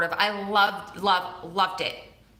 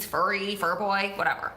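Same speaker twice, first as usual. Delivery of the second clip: ordinary talk, nothing marked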